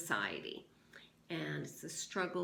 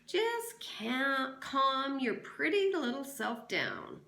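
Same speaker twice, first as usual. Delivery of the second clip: slow lazy drawl, a bit higher and louder; 9 semitones higher, 7 dB louder, slower than usual. Acted. Sheriff drawls at the frantic teacher, slowly. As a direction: stretch it out lazily. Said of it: "in a slow drawl".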